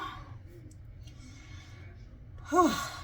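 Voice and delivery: long, breathy exhale; winded sigh. Real, not acted. Vlogger short of breath mid-recipe, winded sigh. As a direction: let out a long puff of air. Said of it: "I'm out of breath".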